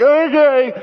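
mock-panicked squeak of consent; nervous agreement. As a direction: agree while clearly rattled. Nervously agrees to release shark.

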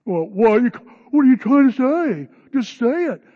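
loud, high confrontational retort; bickering retort. Acted. Tom firing back at the disciple, loud and challenging. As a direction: dare him to say it. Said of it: "The bickering begins".

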